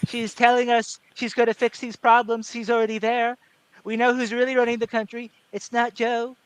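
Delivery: stump-speech Trump, halting phrases; Trump impression. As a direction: do Trump addressing a rally, pausing between chunks. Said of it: "that's kinda how he talks".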